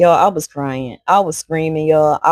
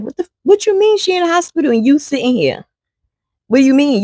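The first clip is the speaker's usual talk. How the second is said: high, outraged demand, voice climbing in disbelief; incredulous outrage; 9 semitones higher than usual. Retold or imitated. Auntie tearing into the fiance, high and outraged. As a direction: rip into him in shocked fury.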